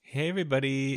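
normal talking voice

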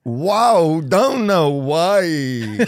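high, very slow copycat amazement; slow, baffled wonder; Christopher Walken. Retold or imitated. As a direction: stretch each word into bending syllables. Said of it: "Christopher Walken sees an amazing magic trick".

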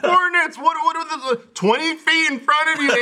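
high, incredulous retort; mock exasperation. Retelling a game call-out to Andy, high exasperated voice. Retold exasperated reply to teammate.

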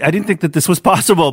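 usual speaking voice

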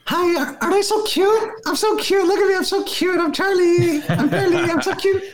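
very high cutesy dog voice. Retold or imitated. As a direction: squeak it out like a tiny cute dog. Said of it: "Caitlin does. It goes something like".

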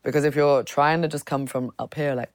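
ordinary talk, nothing marked

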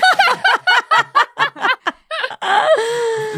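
long high laughing fit; helpless high-pitched laughter. Real, not acted. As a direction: burst out in a high, sustained laugh. Laughing at own joke.

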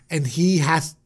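usual speaking voice

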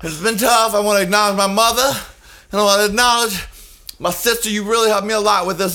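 high-pitched martyr's acceptance speech; mock victim speech. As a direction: imitate a long-suffering hostess thanking family. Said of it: "She's always the victim".

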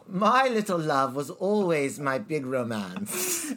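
slow, grand British delivery; British, Maggie Smith. Shown as a demonstration. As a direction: stretch it out in theatrical British. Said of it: "leaning into Maggie Smith".

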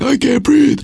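put-on high, wheezed child's complaint; gasping daughter. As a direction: imitate a kid straining for breath. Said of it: "she keeps saying".

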